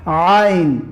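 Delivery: عین with a deep throat sound at the start; ain from the throat. Shown as a demonstration. Teacher shows students the ain sound from the throat. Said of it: "عین به حلق گفته می شود".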